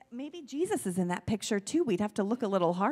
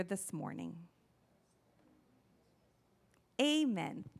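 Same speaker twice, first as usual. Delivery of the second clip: prayer; 3 semitones lower than usual. Acted. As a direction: lead a simple prayer phrase by phrase. Leads children in repeat-after-me prayer.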